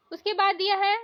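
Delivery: normal talking voice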